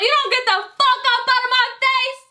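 high, sharp retold clapback; mock confrontation. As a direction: tell the guy off to back away. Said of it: "And I was like".

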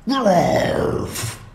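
sudden high yelp of surprised pain; a sharp, startled howl from a bitten ogre; ogre's cry. A narrator's reading. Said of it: "cried the ogre".

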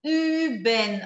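Üben with rounded ü; Üben com ü arredondado; German ü. Shown as a demonstration. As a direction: say Üben with lips pushed forward, tongue down. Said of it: "como é que eu aprendo o som do Ü".

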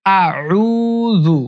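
আউযু said slowly, vowels stretched; slow drawn-out Auzu. Shown as a demonstration. Teacher drawing out আউযু for students. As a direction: model আউযু long and slow. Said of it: "আমার সাথে পড়বেন".

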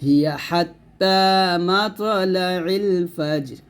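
very slow melodic chant in one breath; tajweed recitation, long held vowels; Quranic recitation. Shown as a demonstration. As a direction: recite it drawn out, joining the phrases. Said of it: "আলাদা পড়তে হবে".

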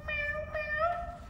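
very high kitten meow; cat meow. Shown as a demonstration. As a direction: squeak it like a kitten. Cat imitation.

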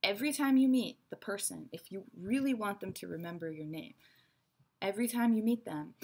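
ordinary delivery

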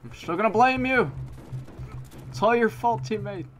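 high, loud playful accusation; teasing a teammate after dying; mock blame. Real, not acted. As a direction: shout the blame half-jokingly.

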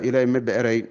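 normal talking voice

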